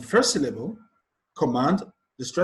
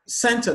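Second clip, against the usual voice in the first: Center stressed as CEN-ter; stress on first syllable; 9 semitones higher, 5 dB louder than usual. Shown as a demonstration. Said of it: "stress is placed on the first syllable".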